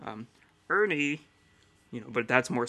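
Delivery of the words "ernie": a hint of glum Bert; serious, down puppet voice. Shown as a demonstration. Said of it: "he also did Bert".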